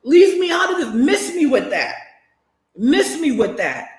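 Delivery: high, incredulous challenge; indignant outburst. Real, not acted. Streamer rejecting viewers who pull them back in, indignant. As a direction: push back hard, voice jumping up in outrage.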